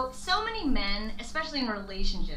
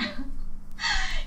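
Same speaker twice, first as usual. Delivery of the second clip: a sudden high burst of laughter. Real, not acted. Podcast host bursting into a high laugh.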